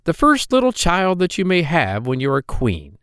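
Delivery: a droll little man, sly and teasing; hobgoblin voice. Acted. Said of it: "said the little dwarf".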